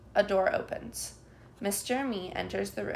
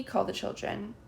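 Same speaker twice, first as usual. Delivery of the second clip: ordinary delivery, nothing marked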